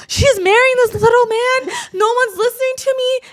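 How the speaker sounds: high, loud whiny complaint; whiny little sister. Retold or imitated. Sister ranting to her therapist about the wedding. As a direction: complain like the ignored youngest child. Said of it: "And she was just like".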